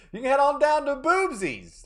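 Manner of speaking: high, loud radio-ad pitch voice; cheesy ad announcer. Pretend. Streamer plugging a fake Boomtown spot, hammed-up announcer. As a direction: sell it like a local TV commercial.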